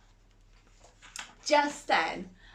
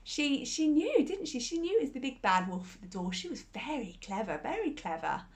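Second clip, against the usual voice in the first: usual speaking voice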